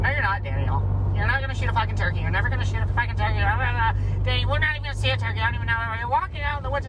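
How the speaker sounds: put-on high, fast, nagging girlfriend voice; mocking girlfriend. Boyfriend mimics girlfriend scolding his hunting, high and fast.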